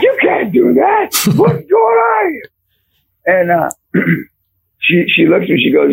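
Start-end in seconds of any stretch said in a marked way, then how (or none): 0.0-2.5 high-pitched, drawn-out disbelief; shocked scolding. Retold or imitated. Wife reacts to coach wrestling a kid, high shocked scolding. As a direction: imitate a scandalized wife, pitched way up.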